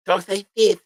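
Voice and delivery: high-pitched lisping impression; lisped mimicry in a high voice. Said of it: "He talks with a lisp".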